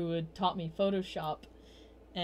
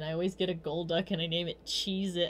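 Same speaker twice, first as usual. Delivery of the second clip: usual speaking voice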